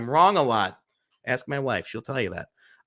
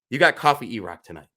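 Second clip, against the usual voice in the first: ordinary talk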